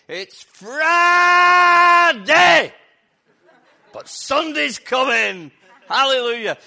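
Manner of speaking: huge, high, slow preacher's shout; old preacher's cry. Retold or imitated. Imitating the elderly preacher shouting to his congregation. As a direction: roar it out like a revival preacher. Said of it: "this elderly preacher came up, and all he said was".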